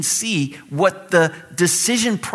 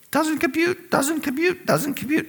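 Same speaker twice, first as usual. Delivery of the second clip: high, soft, robotic repeating voice; mock computer error; 11 semitones higher, 4 dB quieter than usual. Our minds rejecting hard words, high mock-robot voice. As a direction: repeat it like a little jammed machine.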